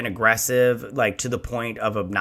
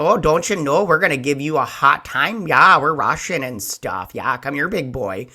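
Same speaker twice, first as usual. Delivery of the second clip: mock Minnesota accent; 2 semitones higher than usual. Scammer luring a big boy, mock Minnesota accent. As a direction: ham up a folksy Minnesota accent. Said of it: "what is the Minnesota accent".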